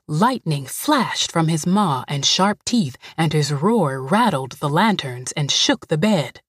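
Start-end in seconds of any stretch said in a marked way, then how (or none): none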